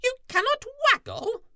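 very high, loud, fast squeaky question; shocked squirrel squeak; stunned squirrel. A narrator's reading. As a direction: Squirrel can't believe it. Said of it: "Squirrel was stunned".

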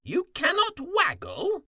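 extremely high, loud disbelief; incredulous, piercing high question; stunned crow. A narrator's reading. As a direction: screech the question in astonishment. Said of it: "Crow was stunned".

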